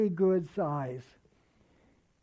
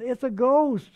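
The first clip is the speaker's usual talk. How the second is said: sudden louder, higher cry of fright; frightened cry; 4 semitones higher, 8 dB louder than usual. Acted. Disciples in the boat crying out in fear, alarmed shout. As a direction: shout it like you've just seen a ghost. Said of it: "And they cried out for fear".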